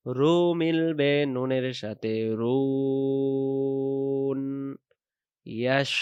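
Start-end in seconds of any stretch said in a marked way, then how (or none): 0.1-4.4 very slow, stretched words; slow drawn-out line. Shown as a demonstration. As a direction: draw each syllable out slowly. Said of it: "এখানে দম ফেলার জায়গা".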